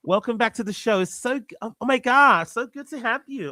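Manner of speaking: high-pitched American woman hosting a show; put-on girly American, high and airy; feminine American accent. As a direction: gush a welcome as an American hostess. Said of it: "You do go very feminine there".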